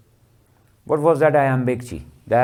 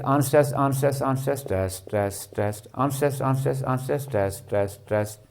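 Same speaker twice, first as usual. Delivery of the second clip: soft, steady chant of ANSWERS three times, then TEST three times; rhythmic, softer recitation on a level tune; musical rhythm demo; 5 dB quieter than usual. Shown as a demonstration. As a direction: tap out ANSWERS and TEST in a regular, repeating beat. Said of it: "Don't you think that this is becoming what? Musical".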